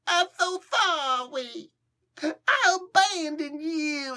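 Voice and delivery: pitiful Apocalypse. Retold or imitated. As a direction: beg like a sobbing child, drawn out. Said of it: "It's the best I can do".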